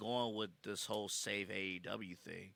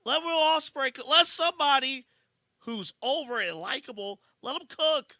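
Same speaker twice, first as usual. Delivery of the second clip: high, loud rallying cry; worked-up fan yelling for his favorite; excited shout; 11 dB louder than usual. Real, not acted. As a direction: plead his case at full volume. Rallying for a wrestler.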